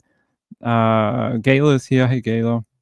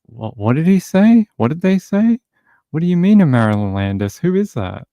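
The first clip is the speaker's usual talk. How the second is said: confused; 6 semitones higher than usual. Retold or imitated. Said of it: "they'll just look at each other and be like".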